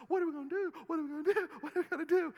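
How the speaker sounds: high, frantic servant voice, asked over and over; panicked servant. Retold or imitated. Prophet's servant panicking at the enemy army, high and frantic. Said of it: "servant's freaking out. He's going".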